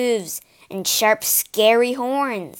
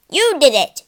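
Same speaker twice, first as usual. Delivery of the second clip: accusing shout; 5 semitones higher, 7 dB louder than usual. Acted. Soldiers accusing each other.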